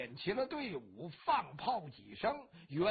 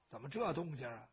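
usual speaking voice

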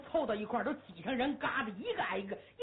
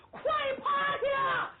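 sudden high yelled warning; shout; 14 semitones higher, 5 dB louder, slower than usual. Acted. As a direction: bark the warning to hit the dirt. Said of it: "胡大海喊". (胡大海高声大喊快趴下)

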